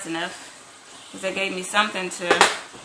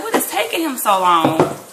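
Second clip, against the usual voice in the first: higher, louder, exasperated retelling; mildly acted impatient complaint; 4 semitones higher, 6 dB louder than usual. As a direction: replay your impatience, voice lifted. Retells her impatience at waiting.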